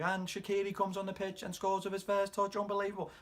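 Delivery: high-pitched commentator calling a goal; mock match commentary. Fan acting out commentary to his wife, high-pitched commentator voice. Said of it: "I was doing commentary".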